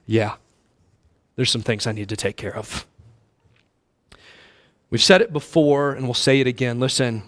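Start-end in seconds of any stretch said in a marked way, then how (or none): 0.1-2.8 softer, lower inner admission; a hint of a rueful sigh. Preacher voicing a listener quietly admitting his sin. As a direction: mutter it to yourself. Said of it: "you're going".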